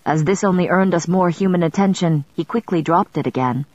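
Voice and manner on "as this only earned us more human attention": ordinary delivery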